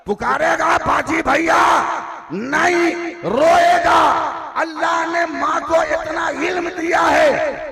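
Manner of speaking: high, loud, drawn-out cry on रोएगा; preaching shout. Real, not acted. Preacher shouting about the crying child. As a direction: hammer it home to the crowd.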